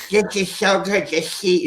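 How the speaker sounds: a Carol Channing warble. Shown as a demonstration. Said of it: "sound like Carol Channing".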